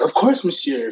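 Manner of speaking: lightly voiced French shopkeeper; a hint of a courteous clerk; polite French clerk voice. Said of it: "he's like".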